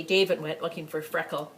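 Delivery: ordinary delivery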